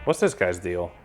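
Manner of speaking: usual speaking voice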